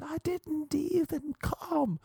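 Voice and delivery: very high, stretched-out squealed protest; mock scream. Screaming at his tormentors, very high mock scream. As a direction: squeal it slowly, imitating a scream. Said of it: "Scream at".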